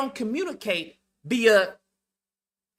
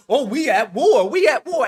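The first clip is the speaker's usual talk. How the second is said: hammed-up mimicry of people crying war; mocking war cry; 2 semitones higher, 8 dB louder than usual. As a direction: chant it loudly in mockery. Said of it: "Negroes talking about".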